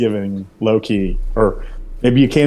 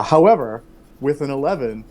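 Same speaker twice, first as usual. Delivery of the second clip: ordinary talk, nothing marked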